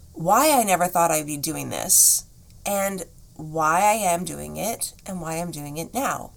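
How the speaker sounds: normal talking voice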